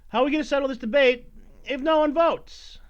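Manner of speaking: normal talking voice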